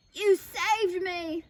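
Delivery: slow, emphatic cry; grateful cry. A narrator's reading. Big Bark thanks his rescuers, crying out. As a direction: draw it out in amazed relief. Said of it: "Big Bark cried".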